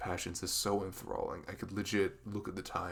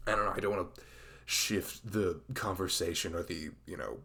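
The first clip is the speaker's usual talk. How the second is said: normal talking voice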